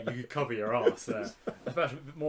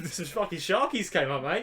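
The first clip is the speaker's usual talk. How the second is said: higher-pitched hammed Australian echo; mock Australian accent; 7 semitones higher, 4 dB louder than usual. Co-host echoing the shark tale, mock Australian. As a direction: put on an Aussie bloke. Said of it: "we always do accents".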